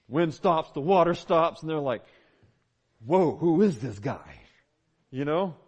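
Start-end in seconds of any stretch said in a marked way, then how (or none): 3.0-4.4 slow, lower-pitched astonished question; playing a stunned onlooker; acted amazement. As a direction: stretch it out in mock awe. Acts out astonished disciples.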